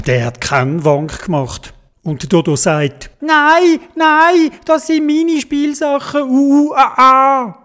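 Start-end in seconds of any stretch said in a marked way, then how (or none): 3.2-7.6 put-on high tantrum whine; whining refusal. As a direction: refuse in a high wail, ending in sobs. Dodo refuses to give up toys.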